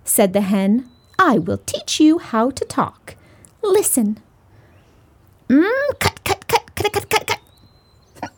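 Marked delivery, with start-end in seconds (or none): 1.2-8.3 high clucking hen voice. Acted. Hen teaches the kitten.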